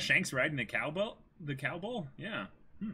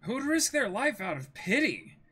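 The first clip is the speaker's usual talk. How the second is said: high, bright rallying line; Luffy's line; 9 semitones higher, 3 dB louder than usual. Acted. Luffy to Usopp, cheerfully brushing off pity. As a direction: toss it off cheerfully.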